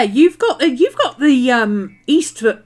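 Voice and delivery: normal talking voice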